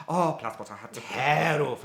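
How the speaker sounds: put-on slow, sing-song Polish-accented repeat; mock Polish reply. A Polish listener correcting her, slow Polish-accented echo. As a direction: echo it back like a Pole correcting you. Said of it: "It's like".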